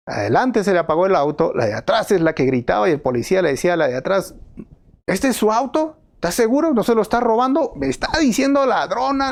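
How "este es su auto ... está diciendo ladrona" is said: higher, mock suspicious questions; accusing officer. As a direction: grill her like a suspicious cop. Officer mocking the driver. (policía burlón acusando a la señora)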